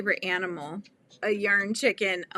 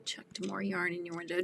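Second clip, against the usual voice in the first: whisper; 2 semitones lower, 9 dB quieter than usual. Real, not acted. Reading chat aloud in a whisper.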